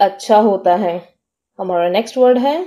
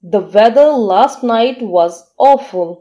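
slow, careful dictation pace; teacher reading an example sentence very slowly; much slower than usual. Shown as a demonstration. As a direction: read it out slowly for learners to follow. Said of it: "इसको sentence में use करके देखते हैं".

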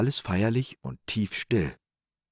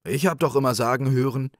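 raised, louder objection; contradicting; 7 semitones higher, 5 dB louder, faster than usual. Acted. Rich man arguing with the old man, contradicting. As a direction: object insistently, pitched up. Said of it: "widersprach der Reiche".

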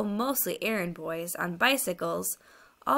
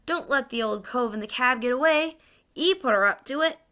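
higher-pitched shout from the crowd; calling out; 5 semitones higher, 5 dB louder than usual. Acted. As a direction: call out a warning to the constable. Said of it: "someone else called out".

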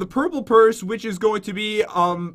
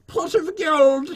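higher, slow mock-Irish phrase; bad Irish accent; 6 semitones higher, much slower than usual. Player warming up before the accent, bad Irish accent. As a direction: ease into a hammy Irish brogue, slowly. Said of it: "just need to say pot of gold".

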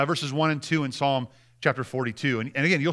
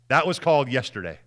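ordinary delivery

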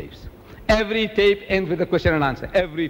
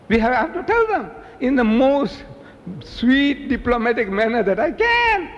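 high, with "sweet" set apart; emphatic insistence; 7 semitones higher than usual. Real, not acted. Speaker urging Muslims to tell Christians tactfully, high with "sweet" isolated. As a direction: pitch it high and isolate "sweet" between pauses.

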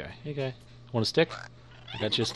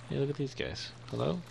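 ordinary delivery

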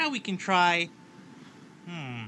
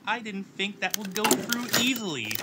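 louder, real surprised oh wow; 5 semitones higher, 7 dB louder than usual. Real, not acted. Streamer reacting to the game's result, surprised.

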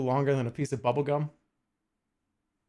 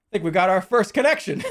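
louder, higher, breaking into a laugh; pleased announcement; 4 semitones higher, 6 dB louder than usual. Real, not acted. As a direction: announce a small win through a laugh. Celebrates first puzzle pieces joined.

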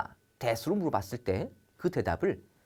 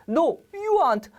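much higher, louder and slowly drawn out; a firm no, pitched way up; 13 semitones higher, 4 dB louder, much slower than usual. Shown as a demonstration. Teacher acting out a refusal for students, high and slow.

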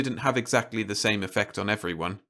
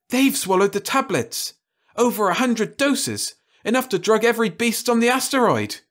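much higher, louder excited cry; excited realisation; 11 semitones higher, 4 dB louder than usual. Acted. Louise excitedly telling Jim the beasts ate the drug. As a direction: blurt out the discovery.

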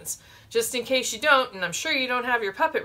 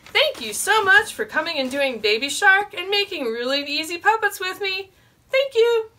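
cheery goodbye; 9 semitones higher, 5 dB louder than usual. Real, not acted.

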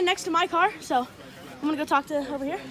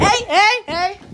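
loud, higher cries of hey; alarmed shouting; 3 semitones higher, 8 dB louder, much slower than usual. Real, not acted. Teen yelling at police grabbing his dad, loud alarm. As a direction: yell to stop them, alarmed.

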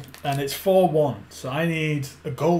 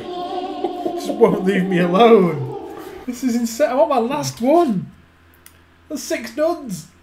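exasperated; 7 semitones higher, 6 dB louder, slower than usual. Real, not acted. Frustrated at bad pulls.

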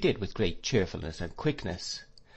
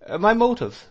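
doctor's high, louder echo of a word; lightly voiced doctor, raised and questioning; Doctor Strong; 12 semitones higher, 7 dB louder than usual. A narrator's reading. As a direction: pitch "my motive" well up in surprise.

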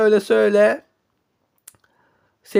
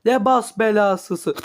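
ordinary talk

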